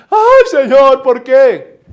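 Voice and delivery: mock wailing plea. Pastor mocking a battered wife complaining to God, laughing whine. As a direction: ham up a self-pitying lament with a laugh.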